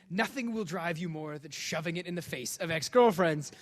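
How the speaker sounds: normal talking voice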